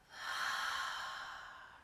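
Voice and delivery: long breathy sigh out through the mouth; audible cleansing out-breath; long exhale sigh. Shown as a demonstration. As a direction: let a full breath go. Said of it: "out through the mouth".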